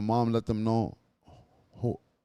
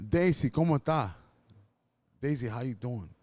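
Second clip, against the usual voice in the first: a hint of the father calling out, pleading; lightly voiced father, eager on the phone; imitating his father; 4 semitones higher, 5 dB louder than usual. Father calling to the mother on a three-way call, mild pleading. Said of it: "You can hear him say".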